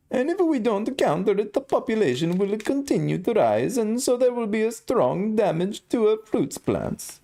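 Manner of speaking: high falsetto mock-Italian accent, Mario style. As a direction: read the quote as a squeaky Mario. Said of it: "certainly talks just like that".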